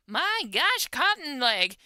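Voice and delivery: loud, high swooping cry of amazement; amazed exclamation. Real, not acted. Player reacting to Cotton Leg driving off the dog, amazed exclamation. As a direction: exclaim it loudly, pitch leaping up then falling.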